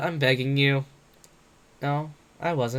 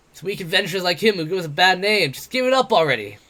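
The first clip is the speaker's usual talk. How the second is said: higher, louder mocking brawler voice; brash, jeering tavern boaster; cocky werewolf adventurer; 5 semitones higher, 5 dB louder than usual. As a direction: taunt him like a swaggering bully. Bete voiced in the story.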